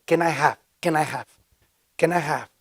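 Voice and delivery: patient repetition of "Can I have?"; calmly drilling "Can I have?" for a newcomer; calm coaching. Retold or imitated. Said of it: "Ok, solo di esto".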